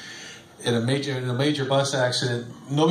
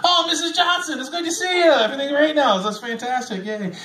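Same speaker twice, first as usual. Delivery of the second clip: much higher, gushing sing-song welcome; gushing greeting; 13 semitones higher, 3 dB louder than usual. Retold or imitated. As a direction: greet her like an old friend, over the top. Gushing greeting of former patient.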